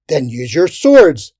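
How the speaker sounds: loud, slow emphatic order; loud retort. A narrator's reading. Man countering the woman's excuse, loud retort. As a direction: counter her forcefully. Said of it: "a man countered".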